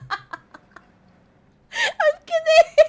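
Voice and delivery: high, laughed-out admission; laughing. Real, not acted. Admitting the boast was a joke, laughing. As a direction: say it through giggles.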